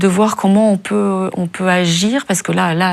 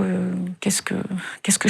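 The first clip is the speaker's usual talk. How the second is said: softer, whispered frantic question to herself; whispered panic; 9 dB quieter than usual. Retold or imitated. Watching the street fight, frightened whispered thought. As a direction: whisper it, scared and unsure what to do.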